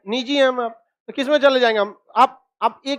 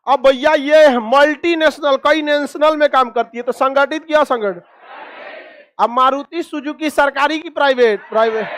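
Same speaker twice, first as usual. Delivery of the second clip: usual speaking voice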